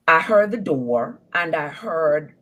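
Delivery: ordinary talk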